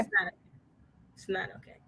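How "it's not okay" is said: whispered. Real, not acted. Whispered repeat.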